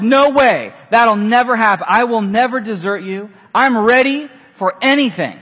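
loud, high, eager vow; eager protest. Retold or imitated. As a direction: swear loyalty with big enthusiasm. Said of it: "He's like".

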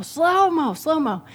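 put-on high, eager kid voice rising on Slow-mo; excited kid. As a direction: call it out like an eager little boy. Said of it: "And, you know".

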